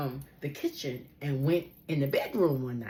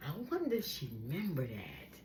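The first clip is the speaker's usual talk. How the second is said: ordinary talk, nothing marked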